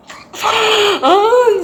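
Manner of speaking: loud embarrassed laughing fit; loud burst of laughter. Real, not acted. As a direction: crack up at your own story. Bursts out after embarrassing confession.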